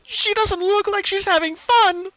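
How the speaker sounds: put-on high, loud, desperate little girl voice; crying young filly. Pickamina crying out to the colt, high and frantic. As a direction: plead over her sister's danger. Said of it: "Pickamina cried out".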